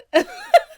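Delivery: high, loud laugh after choking; real laugh. Real, not acted. Laughing at herself after nearly choking, real laugh. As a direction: laugh it off, embarrassed.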